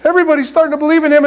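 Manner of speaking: high, loud mock panic; fretting in a rising, hammed-up fluster; freaking out. Apostles panicking over the rabbi's reputation, mock freak-out. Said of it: "they're freaking out".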